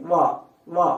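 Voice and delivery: వా with lip against upper teeth; isolated model వా, said twice; labiodental demonstration. Shown as a demonstration. Teacher repeats the example for students, labiodental demonstration. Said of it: "అలా".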